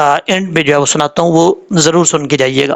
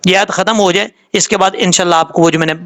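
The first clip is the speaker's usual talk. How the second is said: ordinary talk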